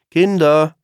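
laut und hoch gerufen; Ruf. Acted. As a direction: die Kinder herbeirufen. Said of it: "ruft Mama Reh". (Mama Reh ruft ihre Kinder laut)

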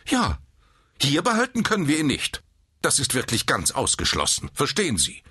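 high, emphatic, insistent voice; stern nurse. A narrator's reading. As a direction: shut down any objection firmly. (streng und bestimmt)

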